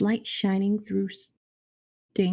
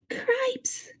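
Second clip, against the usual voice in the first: Ferlo's alarmed cry. A narrator's reading. Brother mouse at the princess's door, alarmed cry. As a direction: squeal it in mock alarm. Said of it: "shouted Ferlo again".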